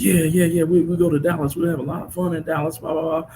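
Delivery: put-on high, eager kid voice; imitating his small son's excitement; excited little boy. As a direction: play the young son thrilled about Dallas. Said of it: "He says".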